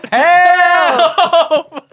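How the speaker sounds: loud, high, drawn-out mock yell; imitated scream. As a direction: holler it like a comic cry for help. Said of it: "he screams out".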